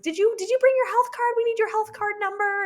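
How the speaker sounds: very high, loud panicked question; frantic midwife. Retold or imitated. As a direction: panic over the health card.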